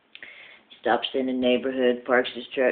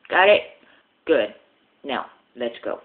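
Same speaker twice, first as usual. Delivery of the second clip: ordinary talk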